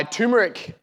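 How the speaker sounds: turmeric said as TOO-muh-rick; turmeric without R. Shown as a demonstration. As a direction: drop the R, saying turmeric as "toomeric". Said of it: "selling it without the R".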